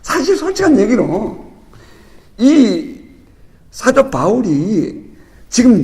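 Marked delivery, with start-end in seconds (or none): none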